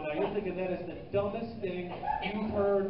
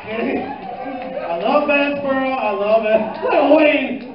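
ordinary talk, nothing marked

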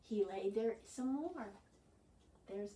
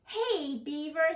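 raised, higher call to a friend; 5 semitones higher, 7 dB louder than usual. Acted. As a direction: hail a friend loudly. Bird calls out to a friend.

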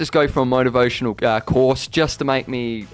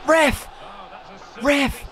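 high, sharp call to the referee; appeal to ref; 10 semitones higher than usual. Real, not acted. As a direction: protest the call.

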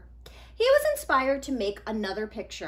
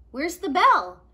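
put-on louder, higher confused kid; puzzled friend; 7 semitones higher, 10 dB louder than usual. Friend confused by the drawing, louder. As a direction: demand to find the bell.

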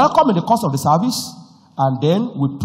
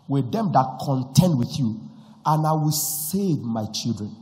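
scripture reading; 3 semitones lower, 9 dB quieter than usual. Acted. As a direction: read it low and measured. Quoting scripture quietly.

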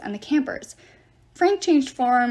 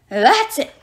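loud, raised cry of indignation; outraged cry; 4 semitones higher, 7 dB louder than usual. A narrator's reading. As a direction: burst out, fed up. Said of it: "Terminus cried".

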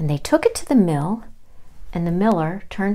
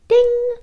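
high ringing ding; oven timer ding; 7 dB louder than usual. Acted.

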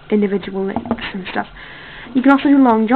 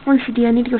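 put-on quick whispered British accent, mum aside; mom's British accent; 4 dB quieter, faster than usual. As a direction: hush it in a fake British accent. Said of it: "I don't know why she insists on the British accent".

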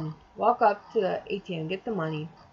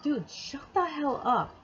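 higher, drawn-out retold complaint; exasperated retort; 6 semitones higher, slower than usual. Retelling her answer to his bitching, exasperated. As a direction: stretch it out, fed up with the griping.

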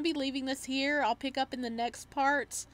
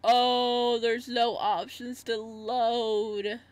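drawn-out dismayed cry; 6 dB louder, much slower than usual. Real, not acted. Dismay at missing load option.

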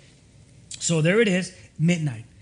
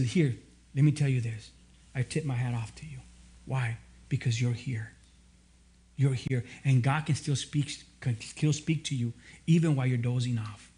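lower, softer, kind pastor's voice; gentle reassurance; 7 semitones lower, 4 dB quieter than usual. Acted. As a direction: reassure them softly that God still speaks. Pastor reassuring sleepy churchgoer.

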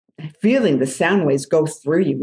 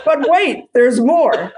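infomercial pitch; 7 semitones higher, 5 dB louder, slower than usual. Pretend. Infomercial catchphrase, laughing.

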